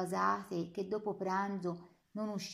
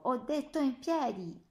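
a lieutenant screaming an order he has repeated; shouted order; 5 semitones higher, 4 dB louder than usual. A narrator's reading. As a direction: yell it louder and higher, losing patience. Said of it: "L'uomo le urlò". (urlato, spazientito)

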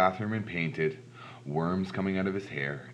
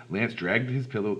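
ordinary delivery

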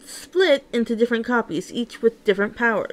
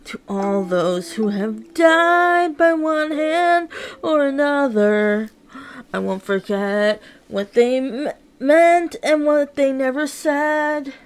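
higher, slow declaimed verse; impassioned poem; 4 semitones higher than usual. Acted. Poet closing the piece, high and impassioned. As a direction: drive the message home.

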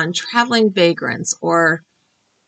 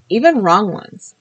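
ordinary delivery

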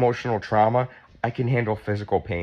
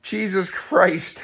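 shocked exclamation; 9 semitones higher, slower than usual. Real, not acted. Shocked at own chest.

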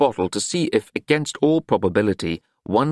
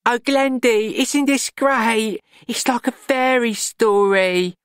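put-on much higher, slow, gushing girl's voice; excited young girl; slower than usual. Juliet gushes to Glenda about her big night, high and dreamy. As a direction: swoon over the fairy-tale night.

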